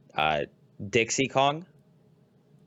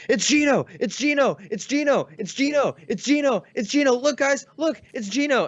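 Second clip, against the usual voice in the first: much higher, louder repeated chant; mock shouting fan; 4 dB louder than usual. Retelling a fan's shouting at the reveal, high and loud. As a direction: yell it over and over like a desperate fan. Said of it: "starts shouting repeatedly".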